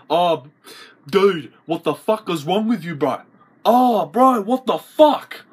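rising to a loud, high cry; acted outrage. Bro confronting bro over an insult, building outrage. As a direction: build from disbelief into hammed-up outrage.

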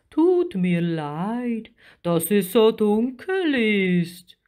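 slow, stretched-out giant creature; big creature apologising, words drawn out; slow apology in a monster voice; deep creature voice. A narrator's reading. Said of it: "fuhr die Stimme fort".

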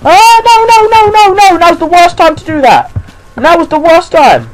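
high, loud frantic protest; shrieked protest at a scare; panicked screaming. Real, not acted. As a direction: wail in terror, very high. Monster appears at bad moment.

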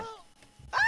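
usual speaking voice

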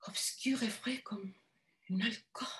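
breathy hushed whisper. A narrator's reading. Narrator whispers the dreamy image of the alcove. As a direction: let it fade into a whisper.

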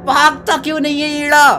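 high, loud exasperated shout; frustrated yell. Real, not acted. Gamer at a character who won't run, frustrated yell.

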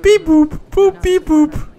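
high-pitched beep boop imitation; robot beeping. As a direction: chirp it like a loud little robot. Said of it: "the, the beep".

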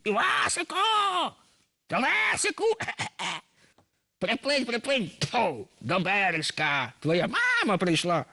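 high, slow, hammed-up snake imitating a mother; mock singing by a wicked snake; rough snake voice. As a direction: screech the call like a serpent mocking a song. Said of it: "Але голос у неї зміїний".

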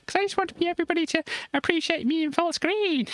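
high, chirpy puppet friend voice; Tracy's voice. Acted. Puppet Tracy asks the host for full screen, high and playful.

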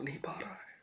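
whisper. Real, not acted. Whispered despair.

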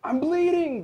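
very high, loud alarmed yelp. A narrator's reading. Fox waking up after the extraction, alarmed yelp. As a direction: shoot the pitch right up and yelp. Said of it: "the fox yelped".

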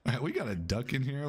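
ordinary delivery

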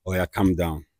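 soft, low hushed calming; soothing calm down. Real, not acted. Host hushing a guest mid-quarrel, quiet and soothing. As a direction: murmur to settle him.

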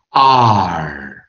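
R as a long "ah" curling into "er"; "ah" plus "er". Shown as a demonstration. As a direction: hold each R and finish it r-coloured. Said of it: "R加儿音的那个".

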